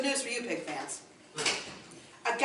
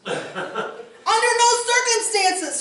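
very high, loud, slow squeal; 11 semitones higher, 6 dB louder, slower than usual. Acted. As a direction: squeal it in horror. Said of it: "squealed two".

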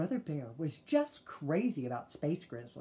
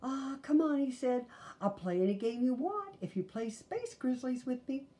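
much higher, coaxing brother; higher whiny coaxing; pleading boy; 9 semitones higher, 3 dB louder than usual. A narrator's reading. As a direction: wheedle her into playing.

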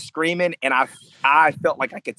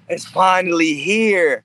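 acted awed excitement; 4 semitones higher, slower than usual. Acting out his walkout moment.

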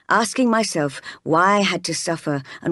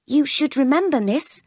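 higher-pitched servant's reminder; admonishing maid; 7 semitones higher than usual. Acted. Bessie cautioning Jane, admonishing. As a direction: remind the child of her place.

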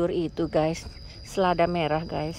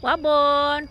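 loud, higher call of the cat's name; 7 semitones higher, 6 dB louder than usual. Real, not acted. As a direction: owner calls her cat Wabon, loud and higher. Calling the cat.

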